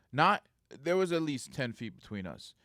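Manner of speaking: ordinary talk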